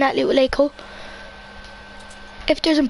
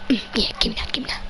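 whispering; 4 semitones lower, 6 dB quieter, faster than usual. Real, not acted. Grabbing loot quietly.

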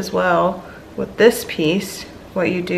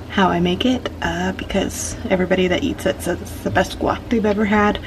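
ordinary talk

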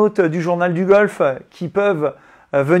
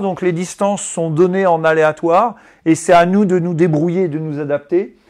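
ordinary talk, nothing marked

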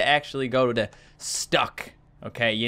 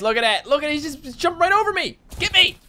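excited; 11 semitones higher than usual. Real, not acted. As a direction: yell at the shark to get you. Shark jumps over him.

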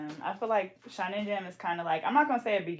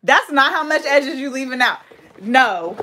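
higher, louder protest; scolding friend's hair choice; 6 semitones higher, 11 dB louder than usual. Real, not acted. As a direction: bark a scandalized objection.

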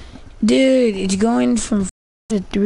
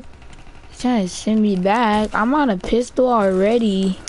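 ordinary talk

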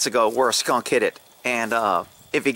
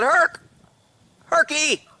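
calling a name; 13 semitones higher, much slower than usual. Retold or imitated. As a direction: call the dog's name out far. Calling for lost dog.

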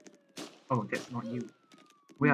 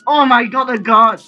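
alarmed yell; 3 semitones higher, 11 dB louder than usual. Real, not acted. Spots a guard while escaping.

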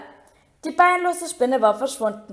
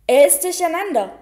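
loud, raised-pitch call; 6 semitones higher, 6 dB louder than usual. A narrator's reading. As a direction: shout it across the classroom. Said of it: "rief Lupin". (lauter, hoher Zuruf)

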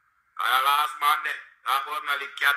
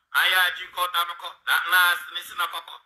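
ordinary talk

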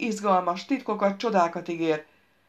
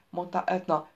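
usual speaking voice

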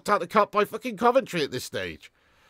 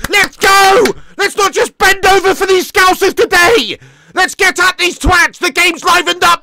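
high, very loud rallying shout; fired-up shouting; 15 dB louder than usual. Real, not acted. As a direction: yell the team on at full force. Fan rallying team after conceding.

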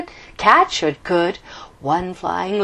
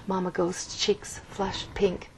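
plain reading voice

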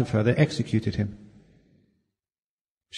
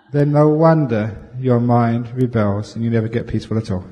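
ordinary talk

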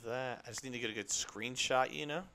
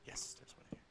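whisper; 12 dB quieter than usual. Real, not acted. Spotting a bee quietly.

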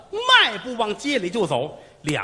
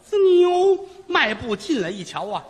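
ordinary delivery, nothing marked

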